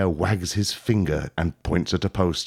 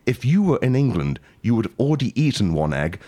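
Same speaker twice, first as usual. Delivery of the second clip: usual speaking voice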